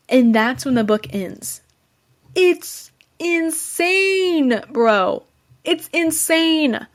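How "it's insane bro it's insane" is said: drawn-out amazement. Real, not acted. Reviewer raving to viewers about the twist, slow, high amazed drawl. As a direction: stretch it out, high and slow, in disbelief.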